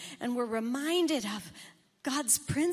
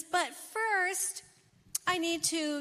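higher, offhand hedge; breezy excuse; 5 semitones higher than usual. Acted. Son putting off his father's advice, breezy. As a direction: brush the promise aside lightly.

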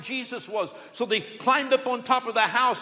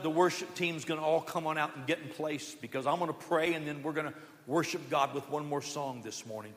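ordinary talk, nothing marked

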